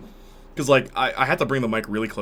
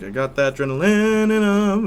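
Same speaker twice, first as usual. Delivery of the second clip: sung in the high octave; 11 semitones higher, 6 dB louder than usual. Shown as a demonstration. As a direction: sing the line up top. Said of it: "He's doing octaves".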